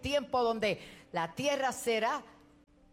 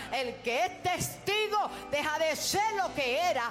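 preacher's voice climbing higher until a full shout on Dios; building to a shout; 4 semitones higher than usual. Real, not acted. A preacher rising to a shouted climax for his congregation. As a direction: build from fervent to a cry of Dios.

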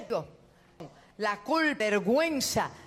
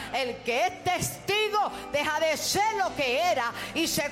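building preaching; 5 semitones higher than usual. Acted. Preacher building to a cry before the church. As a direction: build it up to the shout on Dios.

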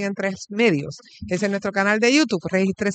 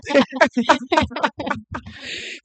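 high burst of laughter; real laugh; 7 semitones higher than usual. Real, not acted. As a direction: genuine amused laughing. Laughs while guests get settled.

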